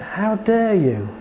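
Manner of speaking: high, slow mock outrage; indignant. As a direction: act out the client's offence. Client reacts to hearing insults.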